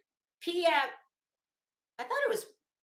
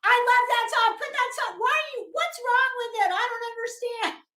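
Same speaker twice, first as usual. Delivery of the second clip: put-on high pleading kid voice, raised; childish whine; 10 semitones higher, 4 dB louder than usual. Remembering childhood, begging parents to keep the song on, in a high kid whine. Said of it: "and I would always say".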